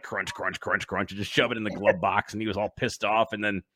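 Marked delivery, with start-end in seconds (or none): 0.0-1.1 low, soft crumpling sounds; mock crunching. Kid crushing the map into the glove box, low and soft. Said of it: "I'm like".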